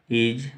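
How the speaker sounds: is said alone, clear and careful; careful isolated is; pronouncing is. Shown as a demonstration. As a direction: sound out is on its own. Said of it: "इसको बोलेंगे".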